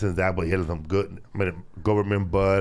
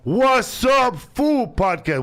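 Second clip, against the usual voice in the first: hype announcer; 4 dB louder, much slower than usual. Real, not acted. Podcast shout-out sign-off.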